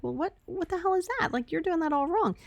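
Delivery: high, scoffing disbelief; incredulous mock voice. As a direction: play the judgmental know-it-all. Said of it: "You may be like".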